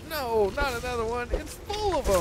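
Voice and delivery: high-pitched horrified wail; screaming at a spider. Real, not acted. Another spider appears.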